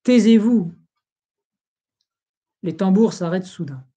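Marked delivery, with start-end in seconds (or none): 0.0-0.7 ordre lancé plus fort, un peu plus haut; voix terrible. A narrator's reading. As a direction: faire taire les tambours d'un coup. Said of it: "dit d'une voix terrible au tambour". (le roi ordonne aux tambours, voix terrible)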